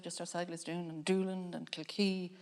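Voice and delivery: normal talking voice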